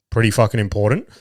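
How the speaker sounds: usual speaking voice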